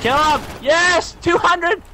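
high, triumphant gamer shouts; excited cheering. Real, not acted. Cheering own kill in game.

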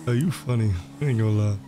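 smooth hustler character. Acted. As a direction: play a smooth-talking record label boss. Plays RP character Tay Cardi.

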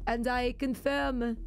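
slow, put-on French accent. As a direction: read it haltingly as a Frenchman. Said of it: "this is going to be my French accent".

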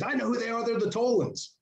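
high-pitched commentator imitation; mock announcer. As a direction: call it out like a ringside commentator. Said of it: "one night he goes".

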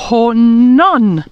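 honnan, H pushed out firmly. Shown as a demonstration. Teacher repeats honnan stressing the H. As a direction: give honnan a strong audible H. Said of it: "avec un H".

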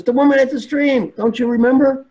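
loud, high exasperation; angry monk. Retold or imitated. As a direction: snap back like an outraged monk. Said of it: "The angry one".

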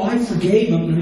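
ordinary talk, nothing marked

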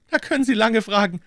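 loud, very high cocky character voice; grinning defiance. A narrator's reading. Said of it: "Mister Jones grinste plötzlich". (grinsend trotzig, laut und hoch)

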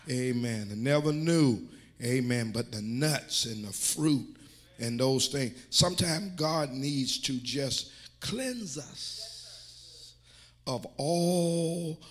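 loud, slow, stretched preacher phrases; fired-up preaching. Real, not acted. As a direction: draw out each key word with force. Preaches with raised force.

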